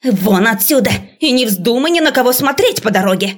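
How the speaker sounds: выше, с напором, сердито; выгоняй её резко и строго; сердитый приказ. A narrator's reading. Преподавательница выгоняет ученицу. (гневный окрик преподавательницы)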